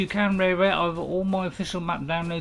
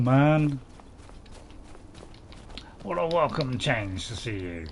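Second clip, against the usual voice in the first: ordinary talk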